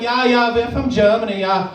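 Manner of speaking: mock German tourist; German accent. German tourist replying to shop worker, German accent. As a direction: answer in a comic German accent. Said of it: "talk to a German tourist".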